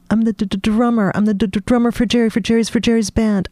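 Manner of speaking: bursting to tell, trips over drummer; stammering excitement. Acted.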